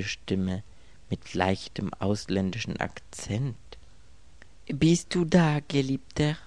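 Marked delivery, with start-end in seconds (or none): 4.7-6.4 a lightly voiced tender woman with a foreign lilt; higher, breathy-free coaxing woman's voice; mysterious caller, softly tender; soft foreign-accented lover. A narrator's reading. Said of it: "sagte die Stimme sanft".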